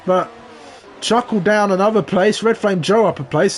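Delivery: high, excited live race call; motorsport commentator. Acted. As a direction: call the incident with rising excitement. Live race call, sudden incident.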